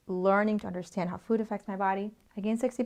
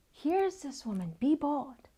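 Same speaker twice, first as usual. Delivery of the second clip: ordinary talk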